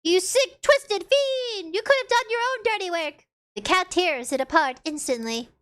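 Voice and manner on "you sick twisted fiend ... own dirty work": indignant scolding; mock outrage. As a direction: hurl the insult in hammed-up disgust. Character berates the player.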